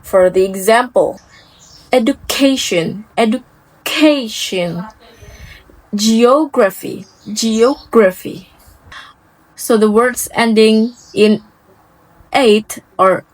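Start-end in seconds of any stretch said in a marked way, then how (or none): 1.9-4.8 education with ca stressed. Shown as a demonstration. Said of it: "Usually stress the second to last syllable". 5.9-8.5 geography with og stressed. Shown as a demonstration. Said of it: "Usually stress the second to last syllable".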